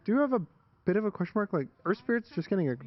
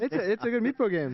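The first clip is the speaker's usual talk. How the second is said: higher put-on teammate voice; lightly imitating a teammate's catchphrase; Yuma impression; 7 semitones higher than usual. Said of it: "this is what Yuma says".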